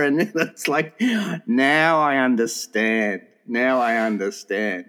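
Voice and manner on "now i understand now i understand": very slow, lower, drawn-out words; dawning realisation. Retold or imitated. Friend finally making sense of Candy, slow knowing realisation. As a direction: let the understanding sink in slowly.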